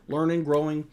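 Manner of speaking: normal talking voice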